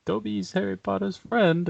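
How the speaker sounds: high, slow little elf voice; Dobby. Acted. Quoting the elf saying his own name, high creature impression. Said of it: "'cause he says it himself".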